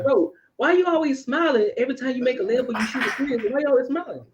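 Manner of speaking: high-pitched imitation of a puzzled complainer; high, needling mock question; mock complaint. Mimics someone challenging his constant smiling.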